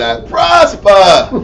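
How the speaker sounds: slow, stretched Boston prosper with no R; mock Boston accent on prosper; East Coast accent imitation. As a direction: drag out prosper as "prospa", dropping the R. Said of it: "perfect East Coast".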